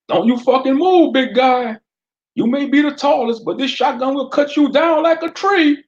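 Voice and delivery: high, loud threatening jeer; deputy taunting Fred over his shotgun. Acted. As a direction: sneer down the shotgun at the big guy. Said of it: "the deputy taunted at Fred".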